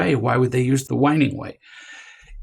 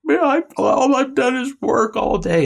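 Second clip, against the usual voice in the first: much higher, put-on whiny kid voice; whiny child; 12 semitones higher than usual. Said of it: "if they whine enough".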